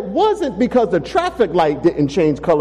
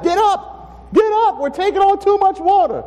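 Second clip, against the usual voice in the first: panicked yelling; 13 semitones higher than usual. Acted. Frightened disciple shaking Jesus awake in the storm, panicked yell. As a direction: shout him awake in panic. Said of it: "one of the disciples that's hitting him".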